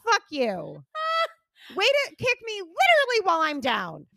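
hammed-up cursing at the gadget; mock outrage. Retold cursing at her watch.

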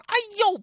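very high faked cry of pain; hammed-up ouch; fake yelp. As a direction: pretend to fall with a yelp. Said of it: "朱仝假装".

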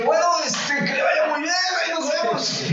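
high, squeaky put-on voice, nervously polite; awkward goodbye. The doctor backing away from the patient with a high, nervous goodbye. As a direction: mimic yourself fleeing the talk with a strained high farewell.

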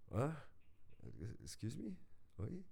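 high, incredulous excuse me; shocked disbelief. Real, not acted. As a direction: react in total disbelief at the request. Shocked reaction to client demand. (incrédulo e chocado)

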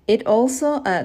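ordinary delivery

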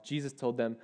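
ordinary delivery

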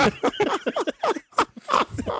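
high squealing laugh. Real, not acted. Big laugh.

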